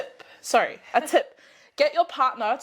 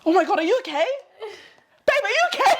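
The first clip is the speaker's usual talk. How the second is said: mock worried partner; 10 semitones higher, 3 dB louder, much faster than usual. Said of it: "was like".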